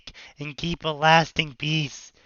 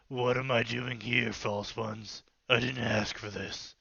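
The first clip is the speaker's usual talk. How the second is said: mysterious stranger voice; 6 semitones lower, 11 dB quieter than usual. Acted. Mysterious man voiced by reader.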